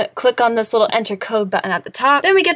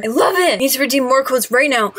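ordinary delivery

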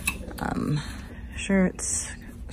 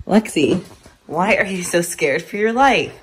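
ordinary talk

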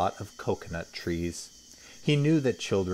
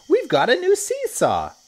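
much higher, louder happy shout; excited children; 9 dB louder than usual. Acted. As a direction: kids thrilled at a new toy.

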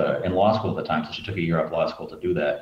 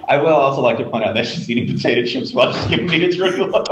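laughing; 8 semitones higher, 6 dB louder than usual. Real, not acted. Caught, laughing.

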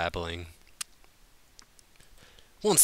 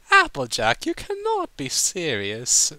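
higher, louder, refined posh lady; prim lady's voice; 7 semitones higher, 7 dB louder than usual. A narrator's reading. Rarity interrupting drunk Applejack, prim and scandalized. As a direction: cut in, all prim disbelief. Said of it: "Rarity interrupted".

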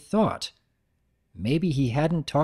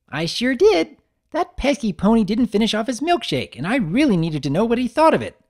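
high, bouncy and eager; high bubbly Pinkie voice; 10 semitones higher, 5 dB louder than usual. Acted. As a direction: chirp it like an excited pony.

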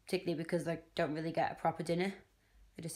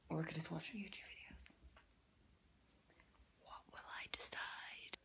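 soft unvoiced whisper to herself; 2 semitones lower, 16 dB quieter than usual. Real, not acted. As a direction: whisper the choice as if thinking aloud.

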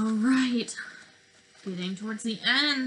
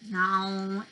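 ordinary talk, nothing marked

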